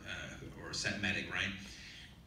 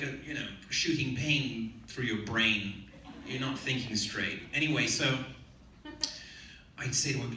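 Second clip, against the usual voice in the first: ordinary talk, nothing marked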